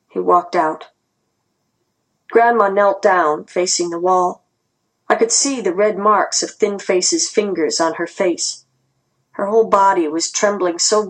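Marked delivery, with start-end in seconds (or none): none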